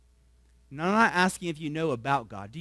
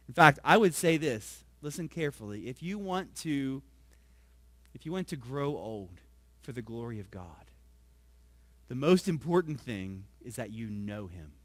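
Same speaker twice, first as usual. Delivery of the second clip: ordinary talk, nothing marked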